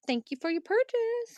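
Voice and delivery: high sweet thank-you; high cute voice. Acted. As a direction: chirp it up high.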